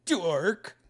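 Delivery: high, loud teasing shout; playfully tease, calling out; mock insult, high and loud; teasing dork. Teasing call out.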